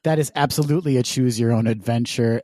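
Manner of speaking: normal talking voice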